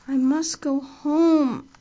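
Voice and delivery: very slow dull insistence; stupidly but determinedly. Acted. Diana, tipsy, insists on going home, slow and dull. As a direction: drag it out woozy but firm. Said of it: "repeated Diana, stupidly but determinedly".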